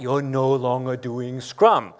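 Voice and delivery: usual speaking voice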